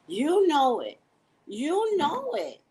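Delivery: loud, high, drawn-out scolding; mock outraged parent. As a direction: scold like a hammed-up indignant parent. Acting out angry parents calling Tracy.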